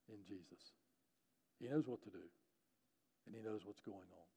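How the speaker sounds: soft, measured pastoral urging; quiet preacher's appeal, fading at the end; hushed exhortation. Acted. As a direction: encourage them gently in short, quiet phrases.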